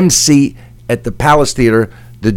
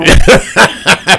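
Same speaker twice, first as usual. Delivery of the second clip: real laugh, higher and brighter; hearty laugh; 6 semitones higher than usual. Real, not acted. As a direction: laugh out at the punchline. Laughing at the story.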